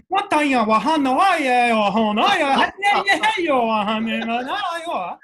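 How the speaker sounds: high, loud fake Japanese through laughter; laughing, loud fake-Japanese gibberish; mock gibberish Japanese. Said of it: "what Google had taught me was Mandarin".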